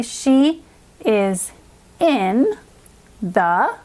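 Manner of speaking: she is in the, a pause after each word; halting, evenly spaced words; word-by-word reading. Shown as a demonstration. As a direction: read it like a beginner, one word at a time. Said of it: "let's now start reading".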